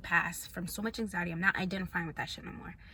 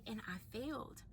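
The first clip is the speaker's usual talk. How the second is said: normal talking voice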